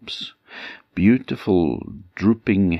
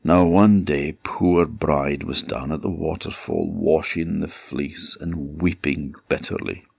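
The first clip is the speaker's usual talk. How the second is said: usual speaking voice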